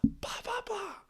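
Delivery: a hammed-up coach squeal at the ref; coach ranting at the ref. Coach complaining to the ref by name, high mock rant. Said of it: "and he's".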